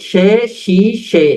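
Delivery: শি সে drawn out slowly for learners; slow teacher drill of শি সে. Shown as a demonstration. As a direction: spell out শি সে very slowly. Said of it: "তাহলে".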